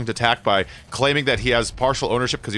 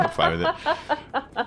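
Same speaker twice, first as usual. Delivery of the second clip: laughing; 13 semitones higher, 5 dB quieter, much slower than usual. Real, not acted. Laughing while joking.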